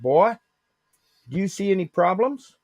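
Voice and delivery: put-on a touch of stern old uncle. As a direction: demand it like a strict uncle. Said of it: "Like that".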